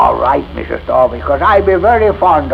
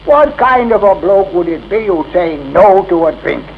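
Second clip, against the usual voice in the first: ordinary delivery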